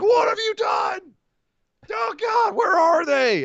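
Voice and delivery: high, shouting mock rage; hammed-up bellowing Braun. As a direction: yell it as the furious big man. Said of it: "Braun comes out like".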